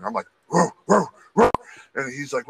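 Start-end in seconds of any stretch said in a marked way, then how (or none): 0.5-1.5 high, sharp mock dog barks; imitated barking. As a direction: bark like a dog at someone. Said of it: "I start barking".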